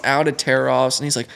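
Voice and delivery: ordinary delivery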